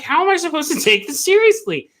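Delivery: high-pitched incredulous complaint; retold exasperation. Viewer fed up with a silly zombie movie, exasperated. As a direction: pitch it way up in disbelief.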